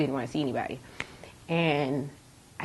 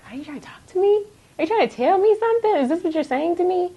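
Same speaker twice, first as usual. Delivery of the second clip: high-pitched, disbelieving questions; re-enacted astonishment, voice lifted high; incredulous questioning; 5 dB louder than usual. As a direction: ask upward in amazed disbelief. Retelling her question to God.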